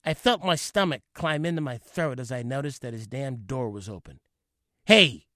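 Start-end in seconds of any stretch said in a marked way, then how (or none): 4.9-5.3 a sharp retold bark of hey, louder; father yelling hey down the hall, loud; shout. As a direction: call him out loudly. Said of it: "he shouted".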